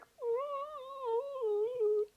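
high, soft crying wail; mock weepy Pearl wail; high sob. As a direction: let out a tiny sobbing whimper. Pearl shows her crying face.

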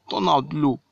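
ordinary talk

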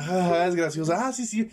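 high, mocking fake laugh, voicing amused people; voz burlona de la gente que se ríe; mock laughing crowd. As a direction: laugh it off like the gullible public. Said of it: "todo empieza con una risa".

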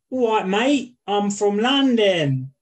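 high, drawn-out Cockney; exaggerated Cockney demo, stretched at the end; Cockney accent. Shown as a demonstration. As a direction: lay on a thick London Cockney. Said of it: "That's a Cockney accent".

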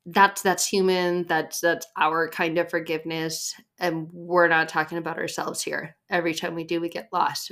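ordinary delivery

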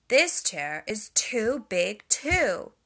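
put-on loud, slow little-girl whine; whining Goldilocks. A narrator's reading. Goldilocks in the second chair, loud slow whine. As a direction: drag the complaint out childishly. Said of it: "she whined".

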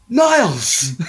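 high, surprised call of a name; mock Martin calling out Niles; excited greeting. Martin's father greets his son Niles at the door, high and surprised.

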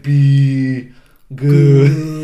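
loud, drawn-out be good; hammed-up parting command; mock stern command. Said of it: "he's like".

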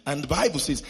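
ordinary talk

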